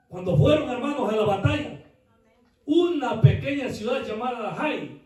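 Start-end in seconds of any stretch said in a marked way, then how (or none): none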